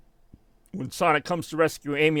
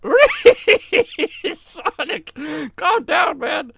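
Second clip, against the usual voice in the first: high, squealing words between laughs; hysterical laughing; 13 semitones higher, 5 dB louder, much slower than usual. Real, not acted. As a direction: blurt it out while unable to stop laughing. Laughing fit continues.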